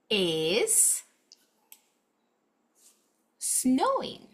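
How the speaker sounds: word by word with long pauses; one word at a time, slow; slow read-along. Shown as a demonstration. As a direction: read slowly for children to follow. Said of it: "let's go ahead and read together".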